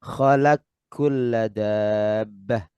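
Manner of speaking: very slow khalaq kulla dabbah with the qaf merged into kaf, khalakkulla. Shown as a demonstration. Teacher slowly reading out the merged form.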